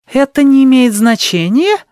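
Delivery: high, loud disbelieving question; incredulous. Acted. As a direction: echo it in disbelief. Sheila incredulous echo.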